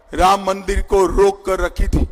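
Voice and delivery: ordinary delivery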